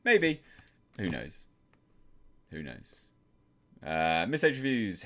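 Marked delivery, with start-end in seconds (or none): 2.5-3.0 dropped to a soft aside; quieter musing. Real, not acted. Repeating a chat answer to himself, softer. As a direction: say it again under your breath.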